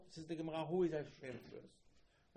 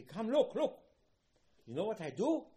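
eager call; 10 semitones higher, 10 dB louder than usual. Acted. God calls angels to look.

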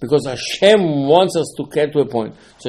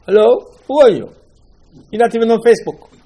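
high, louder scoffing voice; mocking crowd; 9 semitones higher, 5 dB louder than usual. The people of Egypt brushing off Moshe, mocking. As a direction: scoff at the nobody.

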